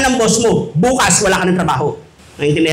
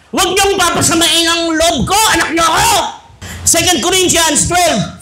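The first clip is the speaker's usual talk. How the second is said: higher, shouted, verse numbers dragged slow; a child quoting scripture at parents, mock shouting; self-righteous child; 6 semitones higher than usual. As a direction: brandish each verse like a weapon. Mock child waving Bible verses.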